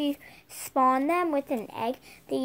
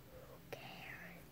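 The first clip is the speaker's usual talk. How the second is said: barely audible whisper; speaking very low; 26 dB quieter than usual. Real, not acted. Vlogger shrugging off not wearing pins, very low whisper. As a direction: drop to a tiny, voiceless murmur. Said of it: "I'm sorry I spoke so low".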